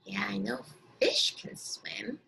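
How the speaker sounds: hushed unvoiced reply; whisper. Real, not acted. Teacher answering imagined pupils, whispered. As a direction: whisper it back like sharing a secret.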